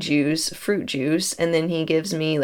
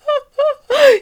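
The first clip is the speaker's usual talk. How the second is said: high, loud burst of laughter; 7 dB louder than usual. Real, not acted. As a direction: crack up out loud. Laughing at the coincidence.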